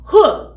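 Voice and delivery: sharp, loud shouted "huh"; chant-ending "huh". Shown as a demonstration. Teacher ending the school chant, loud punched "huh". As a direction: punch out a high, explosive "huh". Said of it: "at the end there is a huh".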